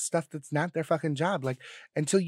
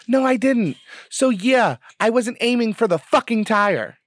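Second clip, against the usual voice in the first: raised, higher, heated comeback to mom; heated retort; 5 semitones higher, 5 dB louder than usual. Retold or imitated. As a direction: fire it back at mom, louder and higher.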